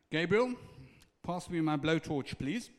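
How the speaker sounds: calling out. Acted. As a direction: summon the angel with mock drama. Jesus calls to Gabriel.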